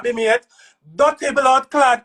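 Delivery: normal talking voice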